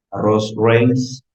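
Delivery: usual speaking voice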